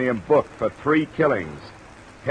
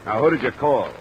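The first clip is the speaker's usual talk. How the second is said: pressing demand; 5 semitones higher, 5 dB louder than usual. Acted. Detective presses suspect.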